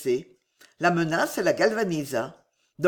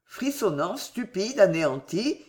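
ordinary delivery

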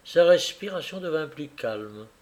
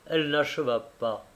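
plain reading voice

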